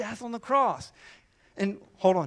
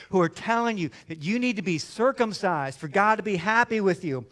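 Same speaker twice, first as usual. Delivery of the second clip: usual speaking voice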